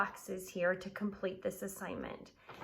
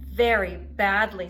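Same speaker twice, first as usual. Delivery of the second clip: Unicorn grumbling; 11 dB louder than usual. A narrator's reading. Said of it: "said Unicorn".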